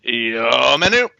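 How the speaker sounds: slow garbled reversed gibberish; backwards speech. Shown as a demonstration. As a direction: carefully mimic a reversed recording. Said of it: "and then I'll try and copy it".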